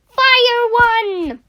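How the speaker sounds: loud, high battle command; pirate captain's ringing command; shouted order. Acted. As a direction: give the gun crew the order at full voice. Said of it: "Bertie ordered".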